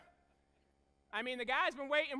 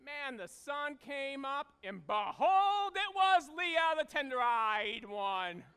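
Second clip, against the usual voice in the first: slow and loud, stretching "it was Leah"; dramatic reveal; 3 semitones higher, 6 dB louder, slower than usual. Acted. Preacher telling the congregation the bride was Leah, slow and loud. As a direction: build it up like a punchline, slowly. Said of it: "dramatic morning reveal".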